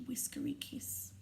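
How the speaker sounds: ordinary delivery, nothing marked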